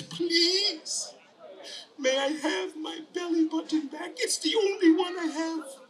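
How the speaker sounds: put-on high child voice, begging; little boy's earnest plea; pleading little boy. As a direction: beg sweetly in a small high voice. Tommy pleads with monster.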